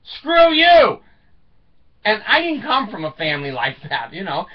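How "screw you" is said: loud, high, bratty shout of defiance; defiant yell. Retold or imitated. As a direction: yell it right in the old man's face. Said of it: "And Alan would look at him and go".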